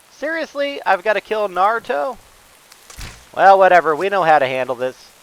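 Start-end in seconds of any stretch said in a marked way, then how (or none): 0.1-2.2 incredulous. Real, not acted. Boss turns out to be Ninetales.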